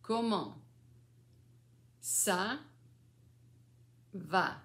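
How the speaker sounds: COMMENT, ÇA, VA each alone with long pauses; word by word. Shown as a demonstration. Grandmother teaching three French words, word by word. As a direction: isolate each word for a beginner. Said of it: "three words".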